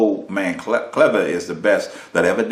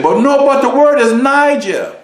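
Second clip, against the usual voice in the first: much higher, louder objection; mock correcting listener; 6 dB louder than usual. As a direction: play the know-it-all correcting him. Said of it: "And you say".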